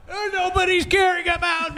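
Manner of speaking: put-on self-pitying cry; mock wail. Mimicking ignored screaming crash victim.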